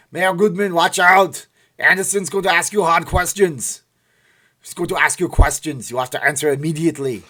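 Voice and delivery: put-on loud, high Austrian-accented Arnold voice; Arnold Schwarzenegger impression. Vlogger warns the mayor in a loud Austrian-accented Arnold voice. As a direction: boom it out in a thick Austrian accent. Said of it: "when you talk like Arnold".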